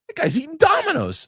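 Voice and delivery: very high, loud mock teen outrage; judgy daughter. Father imitating daughter judging neighbors' pizza, high squeal. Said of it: "She's like".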